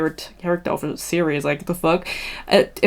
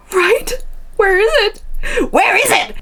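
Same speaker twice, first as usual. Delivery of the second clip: frantic searching; 13 semitones higher than usual. Real, not acted. Searching her phone for screenshot.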